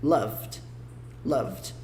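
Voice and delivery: one-beat "Loved", VD ending; correct pronunciation. Shown as a demonstration. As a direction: repeat "Loved" as "lovd". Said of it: "como se esse E nem tivesse".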